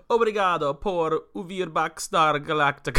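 loud Portuguese in a cartoon Italian accent; shitty Italian accent. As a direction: belt it with a hammy Italian accent. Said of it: "doing it in this shitty Italian accent".

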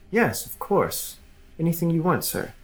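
dreamily obedient, eager to please; simpering. Acted. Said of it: "neutral expression".

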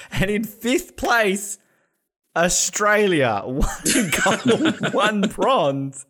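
slow, high drawn-out announcer call; mock-triumphant announcement. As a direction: build up the big reveal, breaking into laughter. Mock-triumphant announcement of Australia's standing.

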